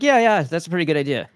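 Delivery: lightly voiced Trump, pleased with himself; Trump impression. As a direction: rattle it off like a smug Trump. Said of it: "that's what Trump's like".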